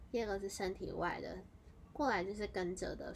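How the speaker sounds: normal talking voice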